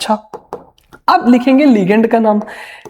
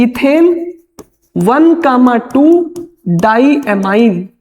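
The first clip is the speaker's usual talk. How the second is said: spells out ethane one comma टू डाईएमाइन slowly, word by word; teacher dictates the name slowly to students; slow dictation; much slower than usual. Shown as a demonstration. Teacher to class, spelling out the ligand name slowly. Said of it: "आज ही मैंने बताया है कि E N को बोलते हैं".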